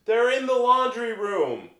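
high, slow projected answer; mock yell back across the house; drawn-out motherly call from afar; imitated mom calling back. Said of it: "she'd just yell back".

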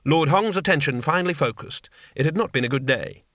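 plain reading voice

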